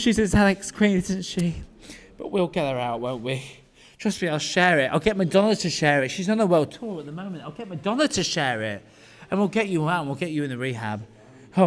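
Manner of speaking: ordinary delivery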